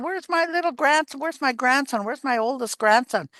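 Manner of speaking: high, eager grandma calling out; calling brightly for the grandson. Retold or imitated. Grandma calling eagerly for her grandson at Easter.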